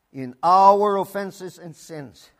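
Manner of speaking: OUR stretched slow and loud; slow phrase, heavy emphasis on OUR; stress on OUR. Shown as a demonstration. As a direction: lean long and hard on the word our. Preacher stresses OUR while repeating the verse.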